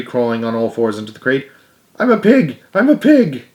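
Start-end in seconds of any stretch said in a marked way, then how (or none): 2.0-3.4 high, loud gleeful chant; playful boy. Acted. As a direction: show off like a silly kid. Boy pretends to be pig.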